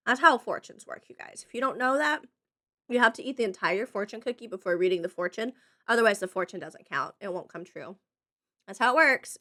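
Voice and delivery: ordinary talk, nothing marked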